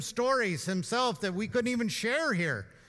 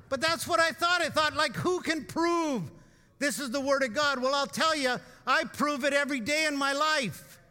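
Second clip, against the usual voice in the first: raised, louder preaching voice, building to a shout; full preaching force; 5 semitones higher, 3 dB louder than usual. Acted. Pastor to congregation, preaching his assurance at full force. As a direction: preach it out with rising fire.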